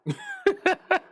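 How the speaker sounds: high burst of laughter; big laugh. Real, not acted. Reacting to cosmic being joke.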